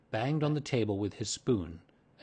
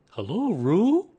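loud, slow, higher bear voice; Pooh's genial greeting; 10 semitones higher, 9 dB louder, slower than usual. A narrator's reading. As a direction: draw the greeting out, rising at the end.

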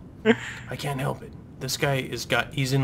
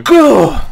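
a tiny loud sneeze; small sneeze; 13 semitones higher, 16 dB louder than usual. Real, not acted. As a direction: sneeze sharply once. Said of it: "That wasn't much of a sneeze".

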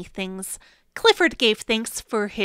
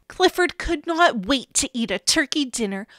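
plain reading voice

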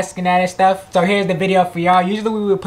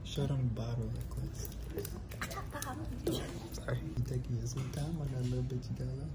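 whispering; 3 semitones lower, 21 dB quieter than usual. Real, not acted. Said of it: "I can't talk loud 'cause there's people in here".

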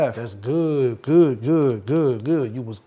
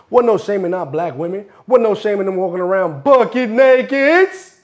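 louder and higher, building to a yell; rising outburst; 5 semitones higher, 5 dB louder than usual. Acted. Storyteller defending the women's lack of shame, building loudly. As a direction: build the repeat up to a loud peak.

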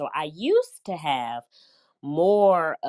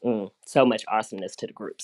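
normal talking voice

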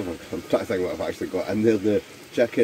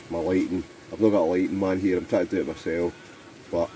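ordinary talk

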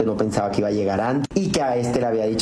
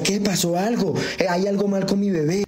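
a lightly voiced anxious husband, higher; worried husband; 8 semitones higher than usual. (marido preocupado, voz algo más aguda)

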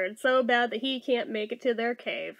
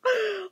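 shocked gasp; 7 semitones higher than usual. Real, not acted. Shocked at spoiler.

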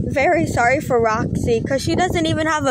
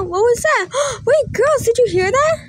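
higher, gasping and alert; startled questioning; 7 semitones higher than usual. Acted. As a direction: play at hearing a strange noise. Doll hears mysterious noise, alarmed.

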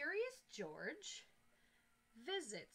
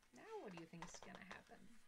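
whisper; 4 semitones lower, 7 dB quieter than usual. Real, not acted. Teacher asks the children secretly.

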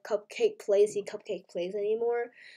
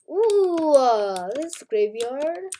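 excited; 4 semitones higher, 4 dB louder, much slower than usual. Real, not acted. Sees the graveyard.

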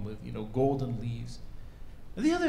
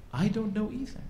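higher-pitched acted admission; candid admission; 8 semitones higher than usual. Rabbi confessing ignorance to his students, candid and high-pitched. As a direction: own up to not knowing, voice lifted.

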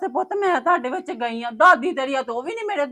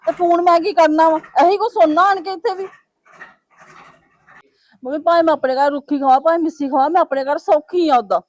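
loud, hurt, raised voice; heated quarrel; 7 dB louder than usual. Acted. Daughter loudly tells mother she'll stay away. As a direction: fire it back at her.

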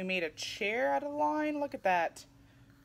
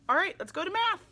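bright cheer, pitched way up and louder; excited, higher and louder; 9 semitones higher, 5 dB louder, faster than usual. Acted. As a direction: sell the next stop with big excitement. Teacher cheering next stop.

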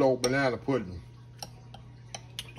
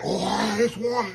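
mock fussy eater; 8 semitones higher, 5 dB louder, much slower than usual. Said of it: "I ain't gonna say it like that".

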